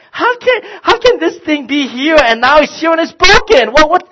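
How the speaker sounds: high, loud disbelief; exasperated rant. Retold or imitated. Dad venting at kids over the broken remote, exasperated. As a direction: sputter with outrage.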